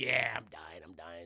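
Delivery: low voice sinking away to almost nothing; dying man. Acted. Old man admits to his friend he's dying, fading. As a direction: let it fade out like a dying man.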